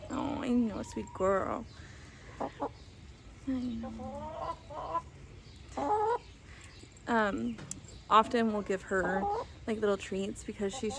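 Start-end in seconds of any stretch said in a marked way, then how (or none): 0.1-5.0 soft, tender cooing to the hen; calm the bird down gently; soothing pet talk. Real, not acted. Soothing the chicken she holds.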